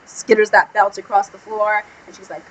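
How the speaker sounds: ordinary talk, nothing marked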